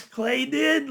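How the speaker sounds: usual speaking voice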